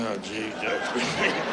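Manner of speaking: low, slow mock groan of weariness; fed-up groan. Worker bees hearing the queen complain, fed-up groan. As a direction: let it sag like a long-suffering coworker.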